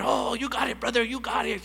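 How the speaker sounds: high, upbeat brotherly reply; friends reassuring. Retold or imitated. The men's group reassuring him, upbeat and brotherly. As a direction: cheer him on like a buddy.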